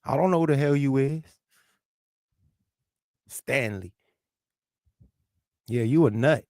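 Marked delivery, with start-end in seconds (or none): none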